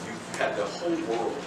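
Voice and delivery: usual speaking voice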